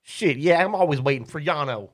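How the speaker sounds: high, loud, fed-up woman; impatient woman. Acted. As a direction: sigh out her complaint. GM plays the woman Azul.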